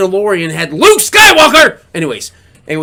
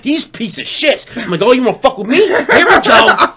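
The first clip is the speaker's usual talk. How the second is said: mock fired-up rant; 9 semitones higher, 4 dB louder than usual. Retold angry reaction to scammers.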